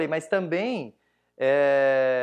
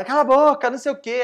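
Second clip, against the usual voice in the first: loud, high mock yell; imitated angry shouting; 8 semitones higher, 9 dB louder than usual. Retelling the man who cursed at him, angry imitated yell. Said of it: "o cara começou a, a me xingar".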